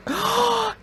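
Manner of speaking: high, tearful gasp; shocked gasp. Real, not acted. Viewer learns the mouse is dead, gasping. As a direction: gasp sharply in real shock.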